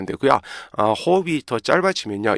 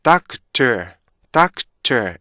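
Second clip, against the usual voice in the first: "doctor" said slowly, each of four beats clear; slow syllable by syllable; much slower than usual. Shown as a demonstration. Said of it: "천천히 읽잖아요".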